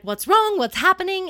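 high, loud panicked questions; mock alarmed parents. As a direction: call out like a parent who heard a scream. Voicing alarmed parents in film scene.

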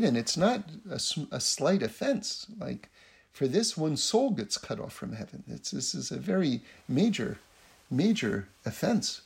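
ordinary delivery

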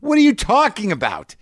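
high, protesting disbelief; exasperated disbelief. Retold or imitated. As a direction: argue back in high disbelief. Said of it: "I'm like".

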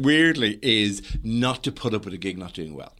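usual speaking voice

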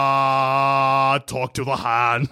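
put-on slow, drawn-out Schwarzenegger accent; Arnie accent. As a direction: stretch the words in a mock Austrian action-hero accent. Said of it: "I'm doing an Arnie accent".

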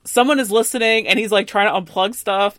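normal talking voice

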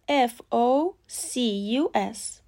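letters spelled out slowly; slow spelling. Shown as a demonstration. Teacher spelling out the word slowly. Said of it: "اولین لغت".

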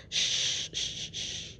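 soft hushing shh; shushing. Retold or imitated. Kelly shushing a crying Alex, soft hushing. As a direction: hush her like a fussing child.

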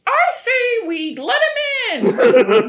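slow, high, loud plea; hammed-up supporter. Gray Stripe backing his friend before the clan, high and slow. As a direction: drag it out like a grand declaration. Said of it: "is like".

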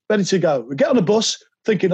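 ordinary talk, nothing marked

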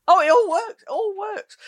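high, sing-song exaggerated echo; mock excitement. Shown as a demonstration. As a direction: parrot it back with mock glee. Echoing with mock enthusiasm.

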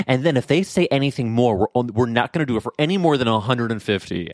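usual speaking voice

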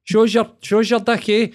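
high-pitched mock Inverness lilt slipping into Fife; hammed-up Inverness impression drifting to Fife; bad Inverness accent. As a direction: badly attempt an Inverness accent, pitched right up. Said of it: "I don't even, that's Pfeiffer".

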